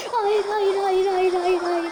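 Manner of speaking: high, loud string of no's; real frantic outburst; panicked protest. Real, not acted. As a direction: plead against the loss in rising panic. Losing the round, repeated refusal.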